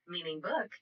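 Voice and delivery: usual speaking voice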